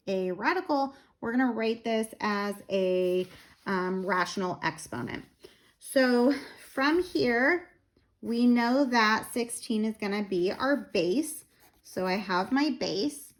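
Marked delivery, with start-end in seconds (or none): none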